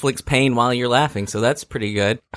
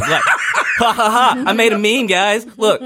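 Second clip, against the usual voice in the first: mocking meme maker; 8 semitones higher, slower than usual. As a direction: gloat like a smug poster.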